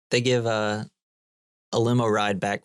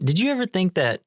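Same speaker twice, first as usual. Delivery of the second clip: high, swooping slacker imitation; theorizing Dude; 7 semitones higher than usual. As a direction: pitch a wild theory as a mock stoner. Acts out the Dude's theory.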